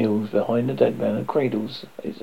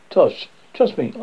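plain reading voice

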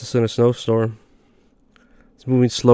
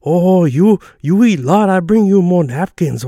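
high, loud mock Chinese-accented waitress; 8 semitones higher, 6 dB louder than usual. Waitress teasing a big guy about his plate, Chinese accent. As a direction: exclaim it in an exaggerated Chinese accent, pitched way up. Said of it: "she literally sounded exactly like that".

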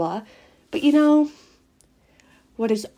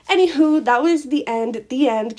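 cheery sign-off; 6 semitones higher, 6 dB louder than usual. Real, not acted. Bright sign-off of the story.

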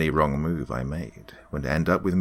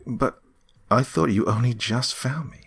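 higher, anxious voice; anxious protest; 9 semitones higher, 3 dB louder than usual. A narrator's reading. Karen doubts the demon's story, anxious protest. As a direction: protest high, confused and uneasy.

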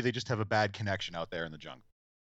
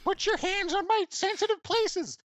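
much higher, slowed mock robot-ape voice; animatronic gorilla; slower than usual. Retelling the gorilla's line to the doctor in a high slow voice. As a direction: squeak it out like a lovesick toy gorilla. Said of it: "the animatronic gorilla is like".

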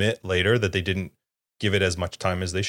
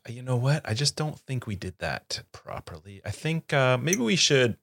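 light hesitant voice, rising pitch; unsure voice, going higher at the end; imitating Tyler; 6 semitones higher than usual. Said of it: "He'll be like".

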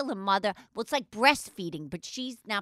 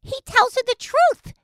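1 indignant outburst; 8 semitones higher, 9 dB louder than usual. Real, not acted. Indignant retelling at husband.

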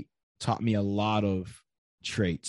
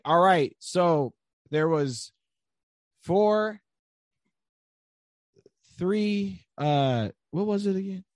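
higher, halting, lost-for-words coach voice; flustered coach; 7 semitones higher, slower than usual. Retold or imitated. New coach to his class, forgetting the riddle, flustered and higher. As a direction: fumble for the riddle, pitched up. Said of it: "I'd be in the lobby and I'd be like".

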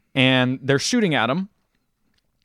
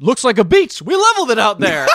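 quote; 10 semitones higher, 6 dB louder than usual. Retold or imitated. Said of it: "He's like".

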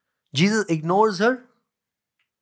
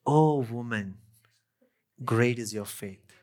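lower, softer, solemn reply; tender commendation; 7 semitones lower, 5 dB quieter than usual. A narrator's reading. Jesus answers the woman of great faith, tenderly. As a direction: drop low and gently honour her.

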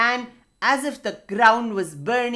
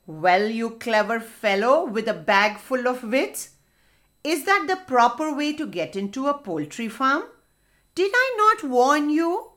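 scolding; 2 semitones higher, 3 dB louder than usual. Acted. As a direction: scold the clever one with rising pitch. Hedgehog scolds the boastful fox.

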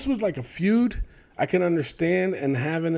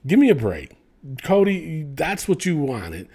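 ordinary talk

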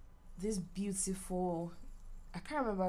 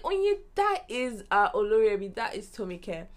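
excited; 3 semitones higher, 8 dB louder than usual. Retold or imitated. Excited recognition of a celebrity.

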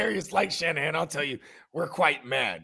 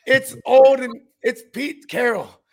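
loud rant; 5 semitones higher, 6 dB louder than usual. Real, not acted. Ranting at fans about Pete Carroll.

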